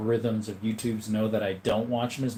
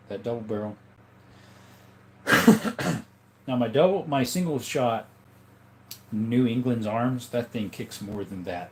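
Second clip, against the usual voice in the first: ordinary talk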